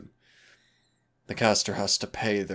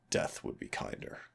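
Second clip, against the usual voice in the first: a soft grim whisper, much softer; 7 dB quieter than usual. Acted. Demon telling the listener Dan's fate, grim whisper. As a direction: breathe it out as a dark aside.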